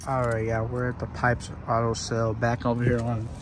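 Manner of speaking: ordinary talk, nothing marked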